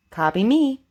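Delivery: ordinary talk, nothing marked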